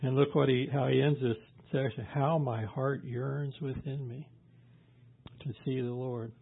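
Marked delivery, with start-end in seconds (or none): 2.0-6.3 slow, soft scripture reading; quiet and drawn out; hushed longing. Retold or imitated. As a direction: let it sink softly, with yearning. Job's longing, first reading.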